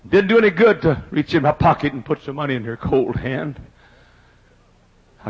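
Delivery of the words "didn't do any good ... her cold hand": usual speaking voice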